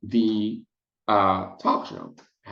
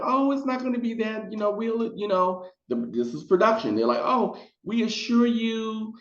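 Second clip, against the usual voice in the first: much higher, sugary mock reassurance; reassuring producers; 10 semitones higher than usual. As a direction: soothe her in a high, syrupy voice. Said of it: "they were like".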